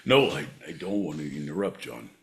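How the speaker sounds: put-on low, soft old Scotsman in a Scottish accent; old Scotsman reminiscing. Plays old Scotsman recalling hard times.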